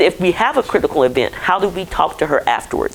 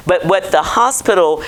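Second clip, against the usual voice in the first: ordinary talk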